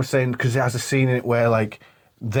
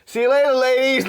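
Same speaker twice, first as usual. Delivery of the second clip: high cheery mock farewell; guy's farewell; 12 semitones higher, 3 dB louder than usual. Retelling the guy seeing off the girls, cheery mock farewell. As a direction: wave them off, lightly voiced. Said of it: "he's like".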